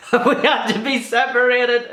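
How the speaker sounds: giggling through the words; can barely get it out for laughing. Real, not acted. Retelling school story to camera, laughing through it.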